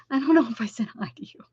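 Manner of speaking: whisper. Real, not acted. Embarrassed aside to a viewer.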